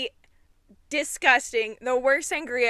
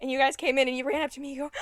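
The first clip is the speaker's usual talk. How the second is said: ordinary talk, nothing marked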